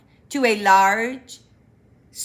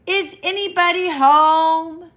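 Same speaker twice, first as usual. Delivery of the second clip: higher, louder call into a hole; 8 semitones higher, 7 dB louder than usual. Acted. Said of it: "called Pooh".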